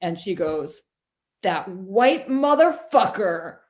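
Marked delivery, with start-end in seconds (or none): 1.4-3.6 slow, louder, higher acted outrage; imitated patient's disgusted outburst; indignant outburst. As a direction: draw out each word in mock indignation. Acted punchline of patient's answer.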